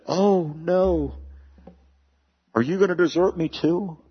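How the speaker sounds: high, whiny, self-pitying plea; hammed-up hurt and needy, pitched high; mock pleading. Imagined whiny Jesus, then rejected.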